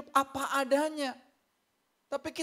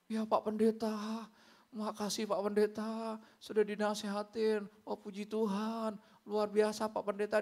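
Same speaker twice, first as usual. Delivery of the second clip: very polite; 2 semitones lower, 5 dB quieter than usual. Retold or imitated. Congregant meeting the pastor face to face, exaggeratedly polite. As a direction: imitate someone meekly humble before a pastor. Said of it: "waduh, sopan banget".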